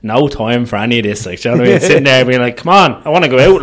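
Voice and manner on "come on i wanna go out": impatient mother. Retold or imitated. Impatient mother at hospital bed.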